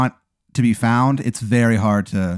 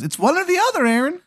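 very high squeaky falsetto; Timmy the Tooth. Acted. Tooth makes Aaron choose, very high. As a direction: lay down terms in a tiny voice. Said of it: "And he goes".

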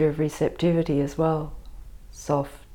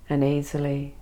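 ordinary talk

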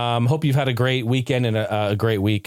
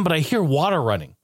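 ordinary delivery

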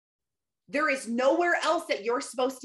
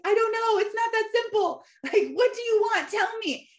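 much higher, louder exasperated mimic; imitating viral audio; 12 semitones higher, 4 dB louder than usual. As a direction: play the overwhelmed woman from the clip. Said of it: "real audio that went around".

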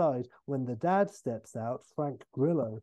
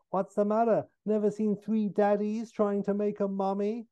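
high, cheeky villain voice; Henry the Eighth Evil; 7 semitones higher than usual. Acted. Evil double to stricken Scotty, high and taunting. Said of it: "And Henry the Eighth Evil".

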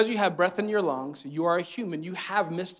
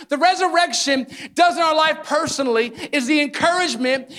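preaching at full force; 11 semitones higher, 7 dB louder than usual. Acted. Pastor preaching hope to the congregation, full force. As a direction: preach it to the rafters with conviction.

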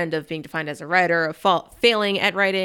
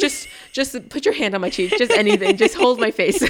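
louder, higher needy begging; mock pleading; 5 semitones higher, 4 dB louder than usual. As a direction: beg playfully for touch.

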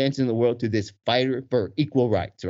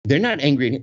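plain reading voice

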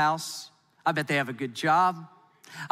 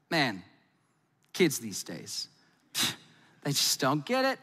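grumbling boomer; 4 semitones lower, 3 dB quieter than usual. Pretend. Lower, softer grumbling boomer.

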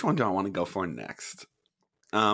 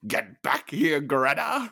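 quoted movie line; 5 semitones higher, slower than usual. Acted.

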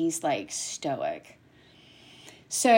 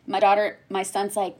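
ordinary talk

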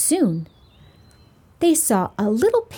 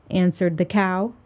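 usual speaking voice

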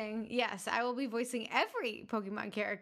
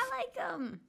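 cat voice; 12 semitones higher, slower than usual. Pretend. Voicing Sprigatito.

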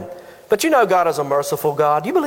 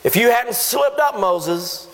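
mock taunting God; 6 semitones higher than usual. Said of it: "rub it in his face and to say".

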